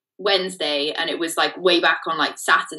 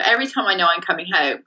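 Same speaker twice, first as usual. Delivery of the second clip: ordinary talk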